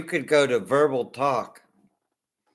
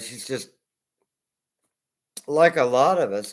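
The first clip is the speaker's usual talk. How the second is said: normal talking voice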